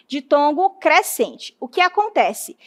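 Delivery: ordinary delivery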